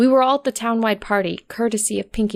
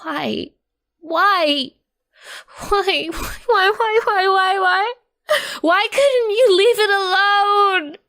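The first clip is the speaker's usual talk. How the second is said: rising shrieks, higher and louder, cracking into sobs; screaming why; 11 semitones higher, 4 dB louder, much slower than usual. A narrator's reading. Nightmare Derpy shrieking at Rose, rising to a crying scream. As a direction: scream it louder each time until it breaks. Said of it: "She screamed, shrieked, and bellowed".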